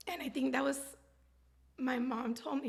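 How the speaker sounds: usual speaking voice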